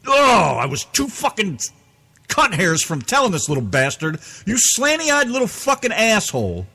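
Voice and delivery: half-shouted angry outburst, nearly losing it; furious shout. Retold or imitated. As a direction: spit out the insult in rage. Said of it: "And I was like".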